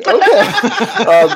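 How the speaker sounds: high incredulous okay breaking into a laugh. Retold or imitated. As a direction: say okay as laughter takes over. Baffled reply to kid's email.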